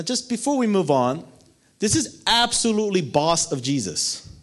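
usual speaking voice

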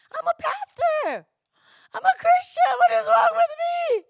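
high, loud despairing cry; anguished outcry. Retold or imitated. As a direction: cry out what is wrong with me. Retells her own menopause outburst.

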